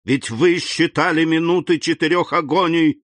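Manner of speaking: выше и всё быстрее, с жаром; нагнетай обвинение; mounting fury. A narrator's reading. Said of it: "с возрастающим жаром". (разгневанный муж обвиняет жену)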